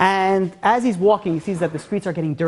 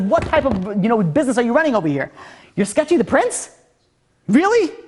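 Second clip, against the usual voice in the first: indignant prince; 5 semitones higher than usual. Retold or imitated. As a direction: storm in and demand an answer. Prince confronting the store owner.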